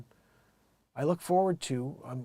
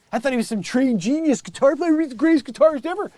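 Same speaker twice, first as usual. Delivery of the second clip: high, loud disbelief, tumbling out faster at the end; astonished; 10 semitones higher, 9 dB louder than usual. Real, not acted. Host learning [name] never took lessons, loudly astonished. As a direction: burst out in amazed protest.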